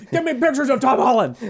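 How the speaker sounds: laughing high editor bark; barking newspaper editor. Retold or imitated. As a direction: bark the order through a laugh. Reprising Jameson impression, laughing.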